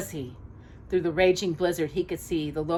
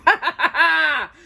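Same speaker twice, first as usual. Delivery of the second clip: high loud evil laughing; triumphant laughter; 11 semitones higher, 7 dB louder than usual. Acted. Jafar laughs at his victory, loud evil laughing. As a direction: cackle it out in victory. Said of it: "Jafar shouted".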